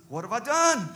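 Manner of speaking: mock-injured exclamation; protesting response. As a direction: plead innocence to an accuser. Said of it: "David's response to him was".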